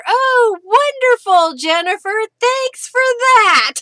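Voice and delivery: high, loud mock delight; sarcastic. Real, not acted. Sarcastic reply to ice forecast.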